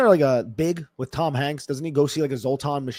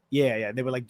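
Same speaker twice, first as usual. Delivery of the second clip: usual speaking voice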